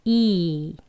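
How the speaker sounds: 이 held long as a pulled ee. Shown as a demonstration. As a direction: stretch 이 out steadily. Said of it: "점점 길게". (이를 점점 길게)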